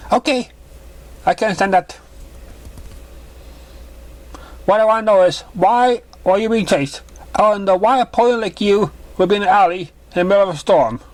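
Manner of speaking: motherly mare voice. Acted. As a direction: play the kind mother pony.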